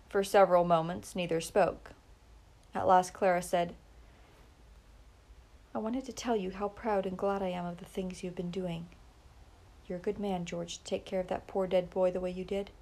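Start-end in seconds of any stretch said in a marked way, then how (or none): 5.7-12.7 tender praise. A narrator's reading.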